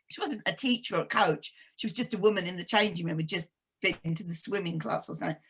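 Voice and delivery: normal talking voice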